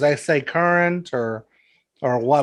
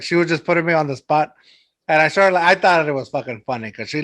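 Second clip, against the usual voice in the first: ordinary delivery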